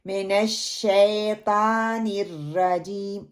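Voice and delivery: slow शैतानिर with light T, not heavy TAW; thin the T in मिनश शैतानिर रजीम; thin taa mistake. Shown as a demonstration. Teacher showing the fourth mistake, light T in शैतानिर. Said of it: "तौ को बारीक पढ़ देते हैं".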